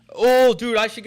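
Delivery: loud, high burst of excitement; excited outburst. Real, not acted. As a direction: blurt it out at a sudden brainwave. Sudden idea for a character voice.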